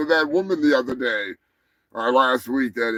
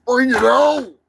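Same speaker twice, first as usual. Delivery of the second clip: hype shout; 8 semitones higher, 5 dB louder than usual. Pretend. Host hyping the animal battle, high hype shout. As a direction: call it out like a fight promoter. Hyping the fight, first take.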